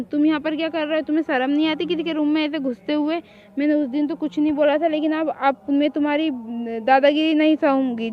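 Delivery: ordinary talk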